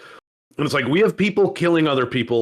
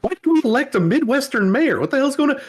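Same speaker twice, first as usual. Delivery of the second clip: higher, exasperated griping; baffled townsperson ranting about the mayor, pitched up; mock baffled complaint; 8 semitones higher than usual. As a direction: rant like a confused local. Acting a confused local complaining.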